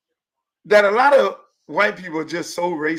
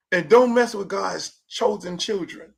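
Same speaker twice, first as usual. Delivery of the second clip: usual speaking voice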